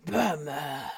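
mock superhero growl; Batman. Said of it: "a very Batman voice".

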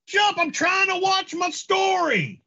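very high, put-on scolding; hammed-up high holler; annoyed household character. Acted household quarrel during prank call.

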